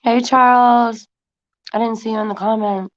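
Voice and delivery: talking while eating chips; words round a mouthful of chips; crunching chips. Real, not acted. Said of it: "eating chips".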